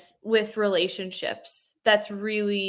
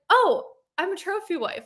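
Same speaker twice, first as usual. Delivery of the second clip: proud, cheeky declaration; 9 semitones higher than usual. Retold or imitated. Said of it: "And now I'm like".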